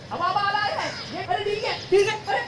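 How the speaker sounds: high, loud urgent commands; excited shouts during the kite fight; frantic urging. Acted. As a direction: push him fast, the kite is coming. Kite fight climax, urgent calls.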